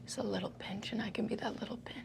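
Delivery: soft, hushed in-character confiding; earnest church kid. Acted. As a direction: confide it quietly, almost under the breath. Player plays earnest Kristen confiding.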